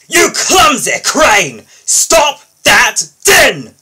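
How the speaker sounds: very loud, high, slow bellowing; an angry engine yelling at a crane; furious shout. Acted. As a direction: roar it over the crashing noise. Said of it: "shouted Spencer as loudly as he could".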